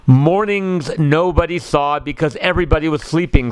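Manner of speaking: plain reading voice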